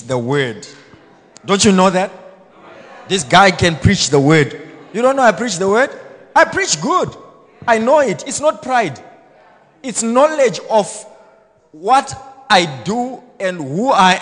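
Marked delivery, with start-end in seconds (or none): 1.5-12.7 loud, high, rising sermon boast; preaching at full force. Acted. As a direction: rouse the church to agree.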